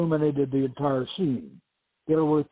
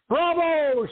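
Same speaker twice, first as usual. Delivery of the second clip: much higher, loud shout of bravo; mocking onlooker cheering, loud shout; 13 semitones higher, 5 dB louder than usual. A narrator's reading. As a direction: call it out across the room. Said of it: "someone shouted".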